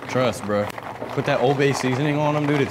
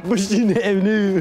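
laughing mock Baltimore accent, pitched up; 5 semitones higher, 4 dB louder than usual. Echoing his friend's local phrase, laughing Baltimore accent. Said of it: "Do they actually say that shit?".

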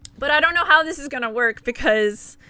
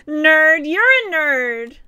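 teasing nerd call; 5 semitones higher, 9 dB louder, much slower than usual. Acted. Teasing herself over glasses.

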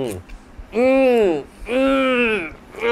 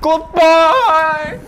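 high, loud cheerful farewell shout; loud goodbye; 9 dB louder than usual. Real, not acted. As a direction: belt out a goodbye to viewers. Farewell to viewers.

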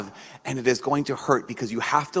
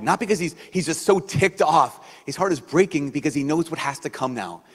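ordinary talk, nothing marked

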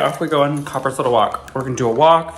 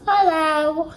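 high sing-song hello. Real, not acted. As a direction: greet the dog in a high voice. Greeting the dog.

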